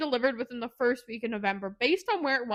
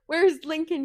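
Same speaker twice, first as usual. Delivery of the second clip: loud, raised-pitch demand; mock angry demand; 5 semitones higher, 7 dB louder than usual. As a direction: demand it as in an acted quarrel. Acted demand in quarrel.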